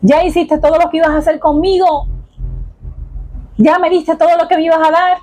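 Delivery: questioning God. Retold or imitated. Man abroad asking God if he is finished with him, questioning. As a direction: press each question upward, pausing between them.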